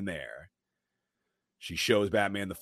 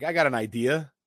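lightly voiced twin, higher and louder; a hint of a scheming twin; sly mock twin voice; twin's sly line; 8 semitones higher, 6 dB louder than usual. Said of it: "Twin's like".

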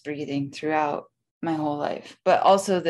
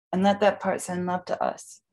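guided breathwork. Acted. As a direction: lead the breathing gently. Guides a box breathing session.

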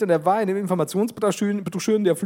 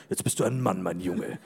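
low, soft conductor voice; deep and gentle, a man's pride; deep fatherly voice; 11 semitones lower, 6 dB quieter than usual. Pretend.

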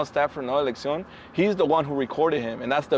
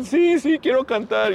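high, louder whiny imitation of a crying drunk; mock sobbing yes; 10 semitones higher, 5 dB louder, slower than usual. Friend retells the crying guest agreeing to sing. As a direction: ham up the teary eager reply.

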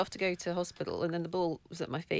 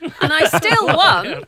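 laughing triumph; 8 semitones higher, 15 dB louder, much slower than usual. Real, not acted. Triumphant tennis story, laughing.

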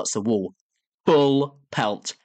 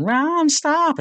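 very high-pitched imitated wail; mock scream; 3 dB louder than usual. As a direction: cry out high, as a parody of pleading. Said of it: "and Sammy's going".

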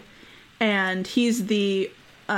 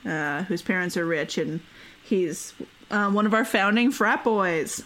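usual speaking voice

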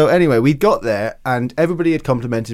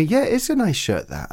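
much higher, lightly mimicked voice; a light take on the host's compliment; imitating Sir Richard; 8 semitones higher than usual. Imitates Sir Richard praising the shirt, lightly voiced. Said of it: "he said to me".

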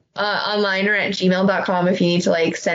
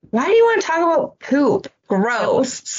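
mock disgust; 6 semitones higher than usual. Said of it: "And then I was like".

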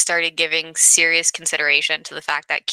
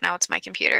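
ordinary talk, nothing marked

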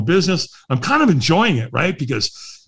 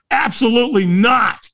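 high-pitched emphatic refusal; emphatic tonality; 9 semitones higher than usual. Retold or imitated. Answering whether he'll walk tonight, emphatic tonality. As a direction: refuse with exaggerated, loaded intonation. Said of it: "I said it with a lot of tonality".

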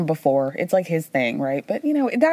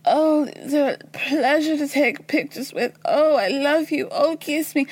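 high, mock-swooning voice; hammed-up high amorous cooing; amorous Lisa; 6 semitones higher than usual. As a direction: gush like a lovestruck admirer, pitched up. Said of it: "she's horny talking an animal yet again".

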